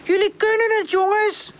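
very high distant call; calling from afar. A narrator's reading. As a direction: cheer them on across a distance. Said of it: "klinkt het van ver".

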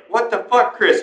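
high-pitched retold dad outburst; incredulous dad impression; dad's shocked outburst. Retells friend's dad's reaction.

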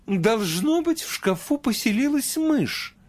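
high alarmed exclaim; mother exclaiming. A narrator's reading. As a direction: cry out in alarm. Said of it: "воскликнула мать наутро".

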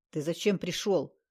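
usual speaking voice

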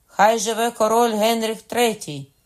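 high, very loud cheer; shouted acclamation. A narrator's reading. Courtiers hail the new king, shouted acclamation. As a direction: echo the cheer at full voice. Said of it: "крикнули те саме".

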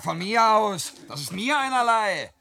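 stung and shouting it off; defiant protest. Acted. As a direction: insist loudly that you don't care.

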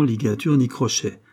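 usual speaking voice

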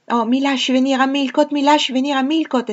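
put-on high, loud child begging; a small girl pleading to come along; excited little girl. Adele begging the governess to go to Millcote, high and excited. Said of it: "esclamò".

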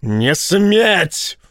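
high, forceful forbidding cry; yelled. A narrator's reading. Admiral forbidding his pilot's sacrifice, yelled. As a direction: forbid it at the top of your range. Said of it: "заорал Рашен".

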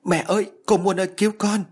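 very high, loud terrified cry; child howling to mother for rescue; screaming for help. A narrator's reading. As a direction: howl for help in pure terror. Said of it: "Tôi gào lên".

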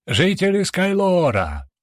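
slow, ringing herald's call; herald's proclamation. A narrator's reading. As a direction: announce it grandly to the crowd. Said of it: "торжественно начал свою речь".

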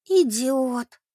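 a drawn groan of dismay, not hushed; quiet groan. A narrator's reading. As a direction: groan it out in exasperation. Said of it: "тихонько простонала Бет". (тихий стон досады)

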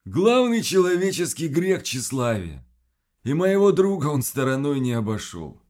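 monster voice. Acted. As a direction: preach like the monster. Voices creature Kvas.

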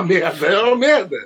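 ordinary talk, nothing marked

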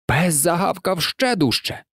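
ordinary delivery

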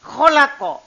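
Kholako with a rasping kh, rising at the end; kho sound. Shown as a demonstration. Teacher showing students a word that must sound different, rasped kh. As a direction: scrape the kh in Kholako from the throat.

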